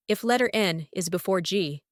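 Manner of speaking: normal talking voice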